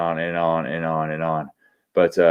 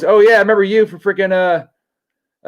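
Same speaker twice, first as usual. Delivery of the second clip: excited recognition; 11 semitones higher, 5 dB louder than usual. Real, not acted. Excited shout out.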